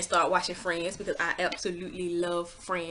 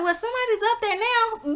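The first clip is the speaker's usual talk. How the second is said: mocking the nurse; 14 semitones higher than usual.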